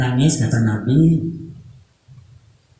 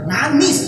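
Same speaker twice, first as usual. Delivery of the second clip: "Nangis" said high and stressed to convince; insisting; 10 semitones higher than usual. Shown as a demonstration. Storyteller insisting to doubtful children: high stressed "Nangis". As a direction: hit "Nangis" high and hard. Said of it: "gak percaya gak?".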